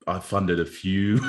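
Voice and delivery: usual speaking voice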